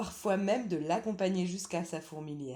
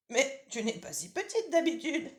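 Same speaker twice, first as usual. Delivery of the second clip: lightly higher, louder granny voice; old grandmother; 9 semitones higher, 5 dB louder than usual. Acted. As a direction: call out as a drowsy old granny from bed.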